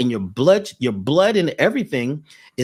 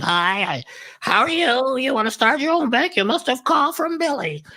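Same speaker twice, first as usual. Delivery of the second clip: much higher, bright put-on receptionist voice; imitating the lady; 10 semitones higher than usual. Imitating the bank lady answering his call, high put-on voice. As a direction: sing it up high like a chirpy phone agent. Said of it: "the lady answered".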